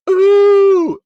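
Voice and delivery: high loud mocking ooh. Vlogger mocking a fan, high loud ooh. Mocking exclamation after quote.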